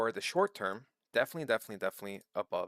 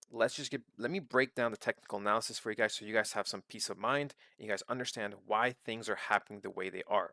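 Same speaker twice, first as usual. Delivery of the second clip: ordinary talk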